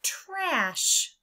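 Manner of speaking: the answer word "trash"; whole word. Shown as a demonstration. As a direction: announce "trash" brightly. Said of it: "Our word is".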